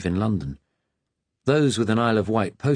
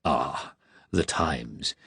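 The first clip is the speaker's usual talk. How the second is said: soft laugh; 5 semitones lower, 4 dB quieter, much slower than usual. A narrator's reading. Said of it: "Hubbold laughed softly".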